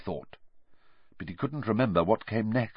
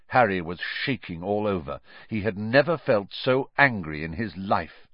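usual speaking voice

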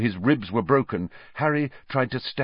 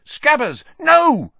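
sharp cry, much higher and louder; 8 dB louder, slower than usual. A narrator's reading. Ron crying out as his rat escapes. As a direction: shout in alarm.

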